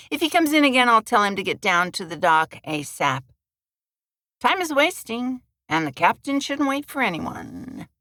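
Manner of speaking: friendly but pushy, lightly done; lightly voiced Madge. A narrator's reading. Bartender introduces herself.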